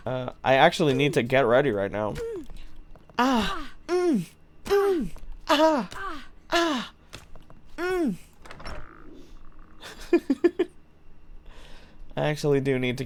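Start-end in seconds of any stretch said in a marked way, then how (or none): 3.2-8.2 high, whimpering fake sobs; hammed-up pretend cry at leaving; mock crying. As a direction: whimper like a sulky child. Whines about having to leave.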